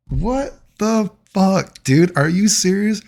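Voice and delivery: high, very slow drawn-out disbelief; incredulous. Retold or imitated. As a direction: stretch it out, can't believe it. His own baffled reaction retold.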